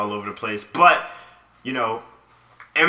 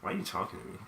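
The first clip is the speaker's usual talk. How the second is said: annoyed mutter; 4 semitones lower, 13 dB quieter than usual. Retold or imitated. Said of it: "And I was just like".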